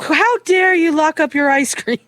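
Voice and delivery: high mock-indignant outburst; hammed-up retold fury; furious daughter. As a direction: imitate outrage, pitch jumping up. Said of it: "She gets furious".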